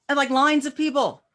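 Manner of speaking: ordinary talk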